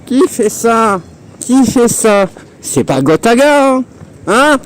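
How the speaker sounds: very high squawky cartoon duck voice. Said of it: "c'est dur de faire une voix de canard". (voix de canard aiguë)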